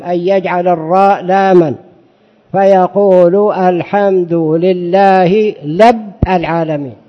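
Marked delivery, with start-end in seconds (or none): 3.5-6.9 الحمد لله لب العالمين said very slowly, ra replaced by lam; lisping ra as lam. Shown as a demonstration. Sheikh imitating a lisper reading al-Fatiha, slow. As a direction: drawl out لب العالمين like someone with a lisp. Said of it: "مثل إنسان ألتغ".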